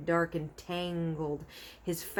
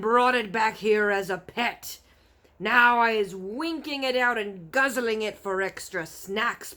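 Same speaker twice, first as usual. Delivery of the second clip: menacing giant; 3 semitones higher, 6 dB louder than usual. A narrator's reading. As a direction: gloat over the snack. Giant threatens.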